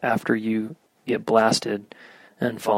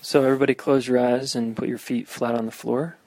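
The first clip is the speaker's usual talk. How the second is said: guided meditation; 5 dB louder than usual. Acted. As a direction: guide listeners through grounding. Leads a meditation.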